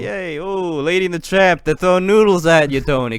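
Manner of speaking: high, loud hammed-up Italian accent. Urging Tony to slurp thrown noodles, mock Italian accent. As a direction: egg him on in mock Italian. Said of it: "I don't have a good fucking Italian".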